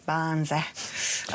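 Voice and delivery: "Barnesy" stretched long and slow; drawn-out nickname. Real, not acted. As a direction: draw out both syllables of "Barnesy". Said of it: "I always feel the need to say it like that".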